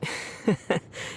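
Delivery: a quiet laugh at a silly idea; soft voiceless chuckle; amused chuckle. Acted. Traveler amused by Paimon's logic.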